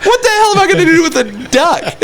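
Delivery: quick, high incredulous question; retold disbelief. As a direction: act out an exasperated dealer. Dealer retold, baffled by duck.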